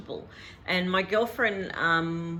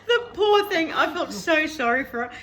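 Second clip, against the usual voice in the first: laughing sympathy; 7 semitones higher, 4 dB louder than usual. Real, not acted. Laughing about the hairdresser.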